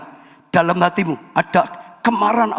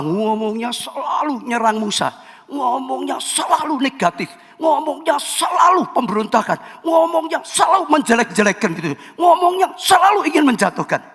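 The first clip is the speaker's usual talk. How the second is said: building denunciation; 7 semitones higher than usual. Real, not acted. Pastor describing Korah's attacks on Moses, building fervent denunciation. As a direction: pile on the charges.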